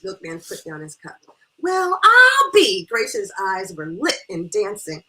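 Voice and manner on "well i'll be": loud, high, slow drawn-out exclamation; thrilled amazement. Acted. Friend astonished at the kinship news, thrilled exclamation. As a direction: stretch it out in loud delight.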